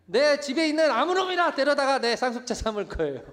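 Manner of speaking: loud, high-pitched protest; indignant yelling complaint; angry outburst. Acted. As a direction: act out a fed-up Abraham shouting at God. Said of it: "화나고 열받고".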